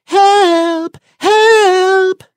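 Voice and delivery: very high, piercing cry for help; harp crying help. Acted. Magic harp calling the giant as Jack steals it, shrill. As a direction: squeal it out in alarm.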